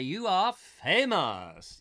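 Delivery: slow, loud, grand announcement; drawn-out proclamation. Acted. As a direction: stretch each word and boom it. Hams up a viewer's compliment.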